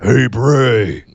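a hint of brooding Batman. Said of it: "Doing his best Bat voice".

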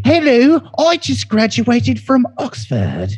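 high, drawn-out Oxford toff; hammed-up upper-crust English caricature; posh British accent. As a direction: put on a fancy Oxford English voice, trailing into posh babble. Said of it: "They send us their best speakers".

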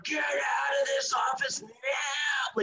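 high, slow, drawn-out demonic croon. Retold or imitated. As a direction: drag every word out like a possessed woman. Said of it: "extended the words in this demon-like voice".

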